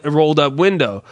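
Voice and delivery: ordinary delivery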